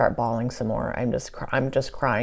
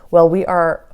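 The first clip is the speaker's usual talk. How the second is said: louder, emphatic echo of her words; echoing the queen; 3 semitones higher, 9 dB louder than usual. Retold or imitated. To the Queen Mother, repeating her words back more loudly. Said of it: "I said to her".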